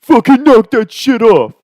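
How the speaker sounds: put-on loud, high drunken outburst; mock-furious shout at whoever slapped you; angry yell. Slapped drunk friend snaps at the pranksters, angry yell.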